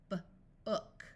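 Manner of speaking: b-ook split, the vowel drawn out slow; stretched b-ook. Shown as a demonstration. Phonics teacher to class, stretching b-ook slowly. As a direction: pull b-ook apart slowly so the short uh shows. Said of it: "like in b-ook".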